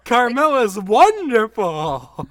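loud lovestruck commentator voice; imitating Corey Graves. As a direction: drag it out, smitten and laughing. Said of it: "Corey would go".

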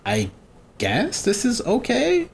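slow, high, questioning tone; reluctant approval. Retold or imitated. Retelling approval of the other characters, reluctant. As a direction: drag it out, unsure, pitch climbing.

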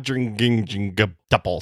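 "quadringingingutuple" low, soft and stammered on repeating syllables; garbled attempt. Co-host having a go at the tough word, "quadringingingutuple" garbled low. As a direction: stumble through "quadringingingutuple", repeating the middle syllable.

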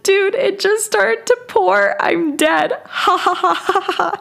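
high-pitched laughing disbelief, breaking into giggles. Real, not acted. Telling Jade about the pouring rain, laughing helplessly. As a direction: laugh it out, near tears, telling your friend you're locked out. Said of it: "We laugh".

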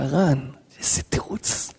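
hushed, breathy whisper of wonder; awed whisper. Real, not acted. Preacher whispering in awe at the Ran's answer. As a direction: drop to a whisper, amazed.

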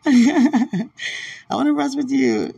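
high, loud, playful innuendo; teasing. Real, not acted. To Dwight, about resting together, playfully teasing. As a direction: tease him with a sly grin.

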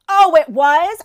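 angry mom confronting the biter's mother; sarcastic challenge. Retold or imitated. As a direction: throw it back at her, sarcastic. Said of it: "And I go".

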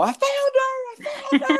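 very high, stretched mock shriek; imitating flipping out. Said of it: "Like, flipping out".

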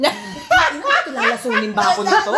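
high, loud outburst through laughter; mock alarm. Retelling own reaction to "poison of choice", mock alarm. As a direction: blurt a comic, incredulous protest. Acts out alarm at puzzling question.

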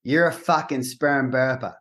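"burper" as "burpa" in Boston speech; Boston street insult, R's dropped; Boston accent. Shown as a demonstration. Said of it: "in the Boston accent".

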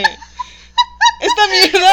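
high, loud laughing blurt. Real, not acted. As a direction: crack up while saying it. Blurts title while laughing.

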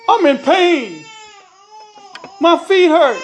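loud, high, hurting wail; pained wailing. A narrator's reading. Beaten husband answers his wife, pained wailing. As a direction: wail it out in pain.

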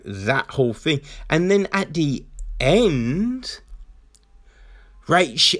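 ordinary delivery

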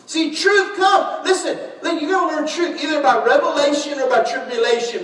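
loud, high preacher's cry; full-force preaching. Acted. Pastor preaches to his congregation at full force. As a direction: preach it out to the church.

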